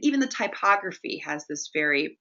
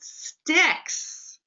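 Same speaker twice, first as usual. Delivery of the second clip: slow emphasis; 9 semitones higher than usual. Shown as a demonstration. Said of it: "teaching demo of sticking".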